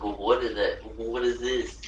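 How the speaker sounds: ordinary talk, nothing marked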